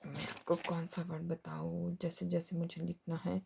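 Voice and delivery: very soft, low thinking aloud; hushed aside. Real, not acted. Teacher searching for the next example word, hushed aside. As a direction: drop right down, almost to a whisper.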